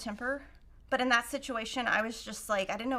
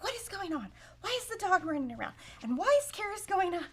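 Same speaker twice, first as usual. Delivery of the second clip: a lightly voiced puzzled husband, pitched higher; mock husband; 7 semitones higher than usual. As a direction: imitate a confused husband, raised pitch. Husband Kevin quoted, upset.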